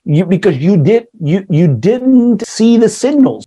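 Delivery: ordinary delivery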